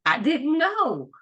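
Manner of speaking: mock amazed onlooker, slow and high; astonished coworkers. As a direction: stretch it out like a coworker taken by surprise. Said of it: "they're laughing and they're like".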